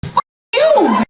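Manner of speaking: high, loud mock curse; mock angry retort. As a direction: spit it back at her in mock fury.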